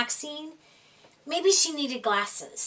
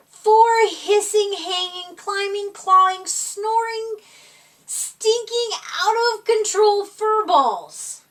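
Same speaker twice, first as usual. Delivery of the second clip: higher and louder, each word punched; building exasperation; 7 semitones higher, 7 dB louder than usual. A narrator's reading. Narrator describing wild kittens, rising exasperated excitement. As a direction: pile up the chaos word by word.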